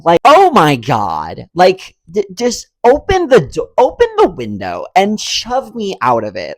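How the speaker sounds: worked-up, loud outburst; exasperated rant. Real, not acted. Passenger whose seat gets kicked again, exasperated rant. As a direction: rant in outrage with punched stressed words. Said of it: "I'm getting so mad".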